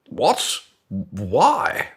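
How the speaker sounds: loud, high burst of disbelief; mock shocked. Colleague questioning the boss's sales target, exaggerated shock. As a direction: blurt it out, stunned. Acted colleague reacts to boss.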